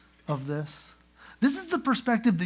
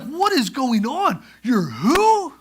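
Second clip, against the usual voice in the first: higher, louder, slow stunned cry; panicked disbelief; 8 semitones higher, 9 dB louder, slower than usual. Retold or imitated. Brothers reeling as Joseph reveals himself, panicked cry. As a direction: gasp it out in sheer panic.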